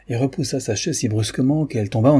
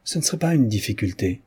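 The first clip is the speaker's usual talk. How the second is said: plain reading voice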